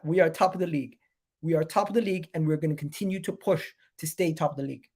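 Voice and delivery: normal talking voice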